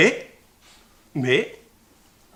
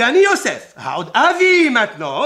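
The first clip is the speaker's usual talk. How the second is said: triumphant cry; 8 semitones higher, 8 dB louder than usual. Shown as a demonstration. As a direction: proclaim Aod Avi with release at last. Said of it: "maintenant il est arrivé le moment".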